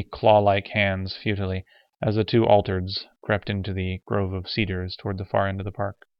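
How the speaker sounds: plain reading voice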